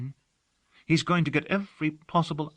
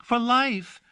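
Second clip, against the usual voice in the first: high, louder cry; cried out; 9 semitones higher, 4 dB louder than usual. A narrator's reading. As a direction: fling it out with fervour. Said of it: "she cried".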